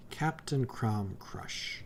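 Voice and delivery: Captain Crom Crush read slowly, word by word; slow careful reading. Real, not acted. Reading out new boss name.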